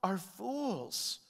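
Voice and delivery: high, slow, forceful declamation; impassioned scripture reading, pitched high; God lamenting. Acted. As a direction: declaim God's complaint against the people. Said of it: "He says".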